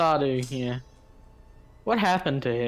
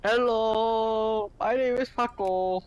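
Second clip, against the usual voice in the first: put-on higher, clumsy gringo Spanish accent; 6 semitones higher, slower than usual. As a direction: ham up a gringo's Spanish accent saying hello.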